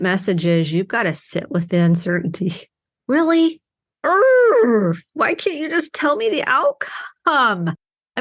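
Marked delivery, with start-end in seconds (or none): 3.1-3.6 a much higher, incredulous really; mock outraged disbelief; exasperated disbelief. Real, not acted. Exasperated reply to her prayers. 4.0-5.0 a loud, high exasperated urgh; loud mock-frustrated groan. Real, not acted. Frustrated at the uncertainty. 5.1-7.7 higher, slow whining plea; mock-whiny complaint to God; whiny pleading. Real, not acted.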